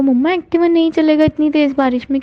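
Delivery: alarmed protest. Retold or imitated. Arguing with mom about rain.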